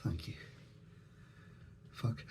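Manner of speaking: ordinary talk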